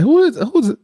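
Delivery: high-pitched incredulous questioning; mock bafflement. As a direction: act baffled, voice shooting up. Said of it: "I'm like".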